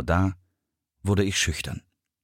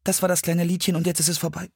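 rushed, higher, shy mumble; very fast, timid; 7 semitones higher, faster than usual. A narrator's reading. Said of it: "sehr schnell und viel zu leise". (sehr schnell und schüchtern)

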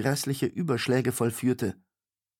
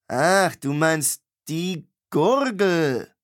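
slow, drawn-out "ach"; dawning realisation; 5 semitones higher, 6 dB louder, much slower than usual. A narrator's reading. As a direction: realise it slowly, a little dim. Innkeeper finally understands.

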